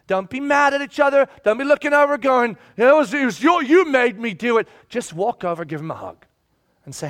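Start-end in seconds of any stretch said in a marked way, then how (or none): 0.1-4.7 raised-voice scolding. Acted. Acted scolding of quarrelling church.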